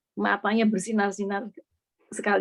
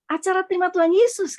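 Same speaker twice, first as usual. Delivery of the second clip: high, louder retold announcement; eager announcement; 9 semitones higher, 5 dB louder than usual. Father tells his child he is ready for Jesus, eager. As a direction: voice lifted as if declaring it eagerly.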